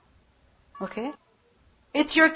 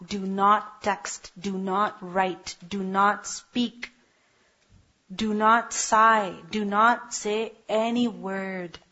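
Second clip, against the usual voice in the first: slow, spaced-out list of don'ts; measured commands, one at a time; slow, deliberate prohibitions; much slower than usual. Shown as a demonstration. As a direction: lean on each "not" slowly.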